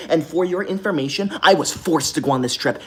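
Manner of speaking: ordinary delivery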